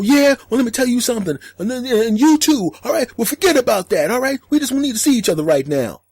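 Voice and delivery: hammed-up phone fight; mock angry phone call. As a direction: play the angry boyfriend on the phone.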